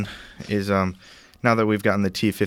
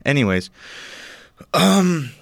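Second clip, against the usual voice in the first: ordinary talk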